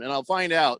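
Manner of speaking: usual speaking voice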